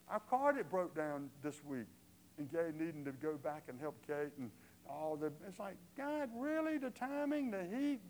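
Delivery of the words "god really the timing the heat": exasperated disbelief. Retold or imitated. Exasperated aside to God.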